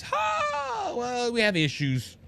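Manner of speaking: sudden high mocking yelp, then a shrugging excuse; hammed-up snide company excuse. Mocking the service provider.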